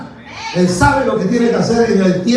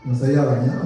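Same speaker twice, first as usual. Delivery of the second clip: usual speaking voice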